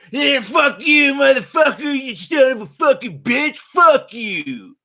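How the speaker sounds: high, loud, slow cussing yell; hammed-up furious driver screaming; imitated road-rage shout. Mocking the angry drivers at the light, hammed-up screaming. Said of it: "They gotta go".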